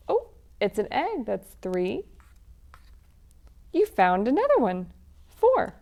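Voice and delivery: bouncy, sing-song picture book chant; lively read-aloud for children. Acted. Reading an Easter egg hunt story to children, sing-song. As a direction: read it like a game for little ones.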